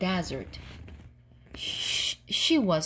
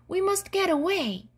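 raised pitch, urgent storybook cry; 9 semitones higher than usual. A narrator's reading. Unicorn tells Wilma they must flee, crying out urgently. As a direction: press the listener to escape, pitched high. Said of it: "cried the unicorn".

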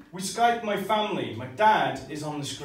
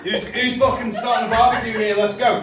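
rowdy tourist cheer; 4 semitones higher, 8 dB louder than usual. Acted. Mock British holidaymaker cheer.

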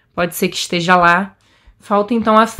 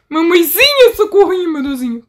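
mock scared; 8 semitones higher, 3 dB louder than usual. Scared of meeting the granny.

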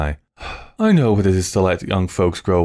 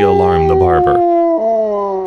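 dog howl; 6 dB louder, much slower than usual. A narrator's reading. Howl imitated in narration.

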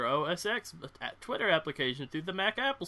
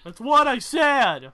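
loud, high, drawn-out bellow; mock shout; 10 semitones higher, 9 dB louder, slower than usual. Joking host insisting to laughing co-hosts, shouting. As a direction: holler it slowly for comic effect.

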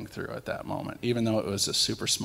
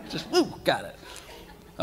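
whispered relief; 8 semitones higher, much slower than usual. Retold or imitated. Whispered relief after catch.

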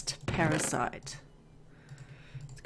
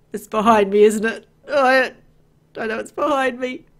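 panicked loud cry, voice climbing; terrified yelp, sure it's right behind; being chased; 3 semitones higher, 10 dB louder than usual. Real, not acted. Fleeing unseen monster in game.

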